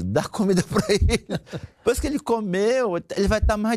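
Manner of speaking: playful, laughing emphasis; laughing insistence. Real, not acted. Coach teasing wives who get irritated, laughing insistence. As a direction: insist again through a chuckle.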